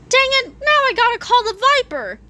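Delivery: quick, high-pitched panicky voice; high fast character voice. Acted.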